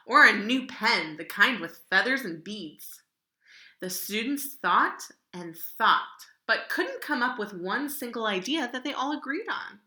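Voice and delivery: ordinary delivery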